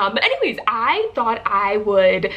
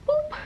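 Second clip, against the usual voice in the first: high soft boop sound; a tiny high playful boop as the curl lands; cute boop; 4 dB quieter than usual. Acted. Placing a curl, sound effect.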